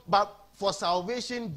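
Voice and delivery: ordinary delivery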